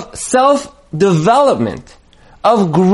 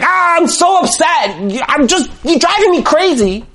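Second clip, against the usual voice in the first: high, strained and exasperated; frustrated outburst; 9 semitones higher than usual. Pretend. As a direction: vent at your spouse, fed up. Spouse venting when something goes wrong.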